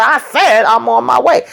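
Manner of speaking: high, insistent mock anger; mock angry. Sabrina insisting to Calvin, mock angry. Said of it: "Sabrina was pissed".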